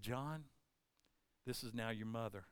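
low, soft acted words; hushed tenderness. Jesus on the cross to John, hushed and low. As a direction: speak gently, voice dropped right down. Dying Jesus entrusts his mother.

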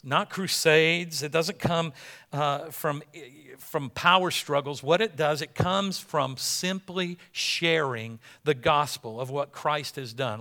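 usual speaking voice

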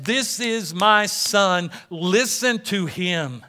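slow, emphatic divine command; God's voice. Acted. God speaking to the disciples about his son, slow commanding voice. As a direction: declare it slowly, word by word. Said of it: "Now God says".

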